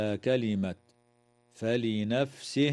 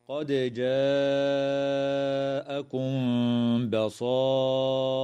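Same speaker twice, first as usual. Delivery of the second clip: Quranic recitation. Shown as a demonstration. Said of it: "قوله تعالى".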